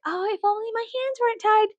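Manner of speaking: high, mocking helpless whine. Mocking the one percent's excuses, high fake-helpless whine. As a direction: sneer it in a falsely helpless voice.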